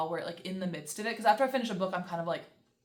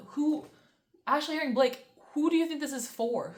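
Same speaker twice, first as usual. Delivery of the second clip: ordinary talk, nothing marked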